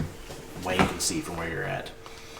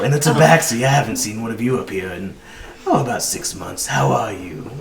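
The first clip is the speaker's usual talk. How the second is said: a hint of a jolly, fussing old shopkeeper; lightly voiced kindly orc gushing over customers; 2 semitones higher, 7 dB louder than usual.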